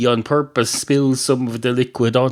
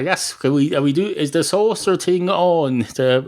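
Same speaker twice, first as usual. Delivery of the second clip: ordinary delivery, nothing marked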